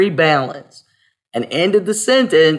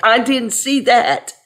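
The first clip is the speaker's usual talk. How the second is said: quoting myself; 8 semitones higher than usual. Retold or imitated. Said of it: "And I'm like".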